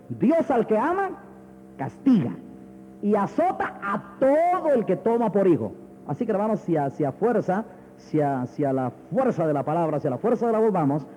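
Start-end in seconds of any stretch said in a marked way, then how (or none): 0.1-5.7 slow, declaimed Bible verse; solemn, emphatic pulpit quotation; forceful preaching. Acted. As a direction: proclaim the verse with drawn-out key words.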